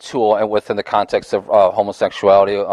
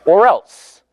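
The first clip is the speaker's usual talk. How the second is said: higher, louder mock ultimatum; mock threat; 9 semitones higher, 6 dB louder than usual. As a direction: toss out a pointed imitation threat. Voicing government's threat to church.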